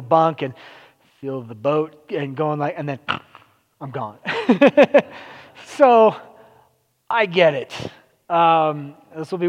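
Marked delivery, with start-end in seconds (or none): 4.2-5.2 a quick real laugh, light and high; short laugh. Real, not acted. Laughing at his own story about falling asleep on the ferry. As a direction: laugh at yourself for dozing off.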